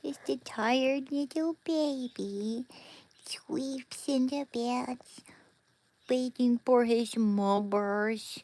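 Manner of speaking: put-on high, slow cooing baby voice; baby talk. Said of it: "baby talk Shando".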